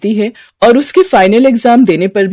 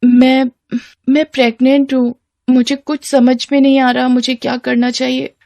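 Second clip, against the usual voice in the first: higher, hesitant confession; worried schoolgirl; 5 semitones higher than usual. Acted. Girl telling boyfriend she is pregnant, anxious and hesitant. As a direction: confess haltingly, fearing others will find out.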